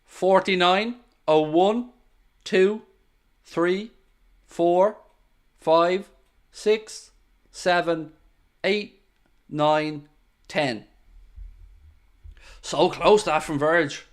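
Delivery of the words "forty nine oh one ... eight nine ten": evenly spaced count, a pause after each number; sync count. Real, not acted. Streamer counts the match clock so viewers can sync, evenly paced count. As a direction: count off the seconds one by one. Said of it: "I'm just about to get to syncing".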